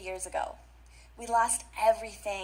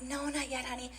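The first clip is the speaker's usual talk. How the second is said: put-on soft, tender answer to a child; gentle reply; 9 dB quieter than usual. A mother softly answering her little boy, tender. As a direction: let him down gently and softly.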